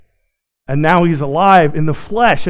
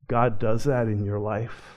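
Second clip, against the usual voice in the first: usual speaking voice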